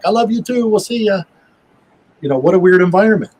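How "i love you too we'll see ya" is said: high, mock calling-back voice; retold shout back. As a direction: imitate hollering a reply, pitched up. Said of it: "to shout back".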